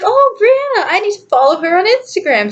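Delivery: high, eager recalled thought. Retold or imitated. Finding a classmate's profile, high and eager. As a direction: jump up high on the name, then settle. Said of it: "So I was like".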